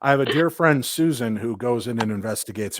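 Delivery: ordinary talk, nothing marked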